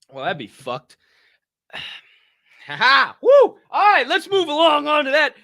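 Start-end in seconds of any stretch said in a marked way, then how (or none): 2.7-5.3 very high, loud whoop then shout; whooping hype. Real, not acted. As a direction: pump yourself up. Hyping himself up.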